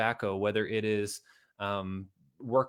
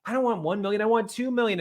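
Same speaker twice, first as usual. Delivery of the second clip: high and loud, exasperated; mock-frustrated ambitious rant; fed-up rant; 10 semitones higher, 5 dB louder than usual. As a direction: vent like you're sick of settling. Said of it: "are like".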